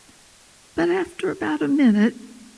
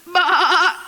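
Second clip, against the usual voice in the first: wavering, higher-pitched baa; goat bleat; 5 semitones higher, 4 dB louder than usual. Acted. Terrified cub answers the big lion, bleating like a goat. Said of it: "looked up at him and went".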